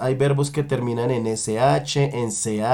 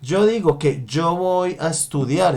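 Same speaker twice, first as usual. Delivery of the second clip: ordinary talk, nothing marked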